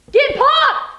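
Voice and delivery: high loud slogan shout; hype shout. Acted. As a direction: yell it like an ad tagline.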